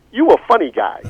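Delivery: high, loud, amused big-man voice; a jolly giant chuckling back; imitating Dawkins. To his teasing seatmate, a high loud amused imitation. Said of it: "and he goes".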